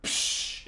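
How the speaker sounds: breathy mock cymbal crash; cymbal sound. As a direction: blow out a short psh like a cymbal. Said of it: "instead of it going".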